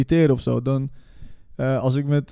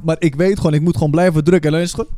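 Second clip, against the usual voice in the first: usual speaking voice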